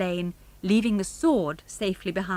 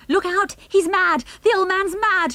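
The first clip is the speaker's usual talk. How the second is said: high, loud alarm call; shouting; 12 semitones higher, 6 dB louder than usual. A narrator's reading. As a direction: shout a frantic warning. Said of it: "shouted Mister Durlston".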